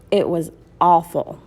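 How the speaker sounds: usual speaking voice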